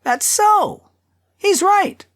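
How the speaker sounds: very high crowd cries; crowd voices. A narrator's reading. Many voices backing the tanner, high crowd cries. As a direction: call out high, like voices from a packed hall. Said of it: "Many voices".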